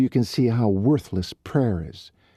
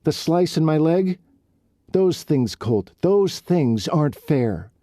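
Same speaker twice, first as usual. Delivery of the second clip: higher and louder, rising indignation; upset outburst; 7 semitones higher, 4 dB louder than usual. Acted. Riley venting her hurt at Colt, indignant and loud. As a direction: pour out every unfair thing, hurt and angry.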